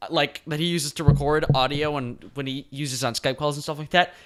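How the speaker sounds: ordinary delivery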